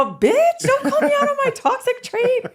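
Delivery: high, loud laughing protest; mock outrage. Speaker squealing back at teasing friend, high and laughing. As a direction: squeal at being called out, Australian friend teasing. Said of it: "I was like".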